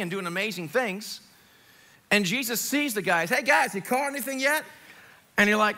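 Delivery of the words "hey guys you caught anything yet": higher-pitched friendly hail; acted call to men in a boat. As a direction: call across the water to the fishermen. Jesus calling out to fishermen.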